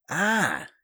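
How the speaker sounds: high, short cry of alarm; startled shout. A narrator's reading. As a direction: yelp as if bitten. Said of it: "Janetti shouted, recoiling as if a snake had bit him".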